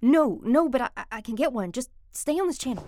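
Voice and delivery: urgent loud reply. Acted. Urgent reply over radio.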